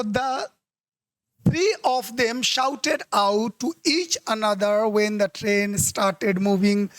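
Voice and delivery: teacher reading an exam sentence very slowly; very slow dictation. Shown as a demonstration. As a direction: drag each word out slowly. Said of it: "reads the exam sentence slowly".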